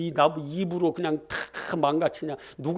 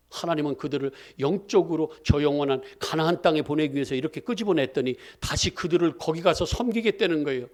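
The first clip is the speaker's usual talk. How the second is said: ordinary talk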